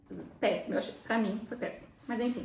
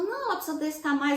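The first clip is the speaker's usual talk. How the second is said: insistent midwife; 6 semitones higher, 4 dB louder than usual. Retold or imitated. Midwife insists, mother refuses position.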